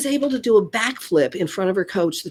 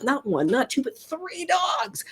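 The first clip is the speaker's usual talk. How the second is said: emphatic amazement; 6 semitones higher, 4 dB quieter than usual. Real, not acted. Emphatic count for effect.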